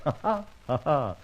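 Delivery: a short mocking ha ha ha; scoffing laugh. Scoffing at the police, a mocking laugh. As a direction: laugh off Scotland Yard.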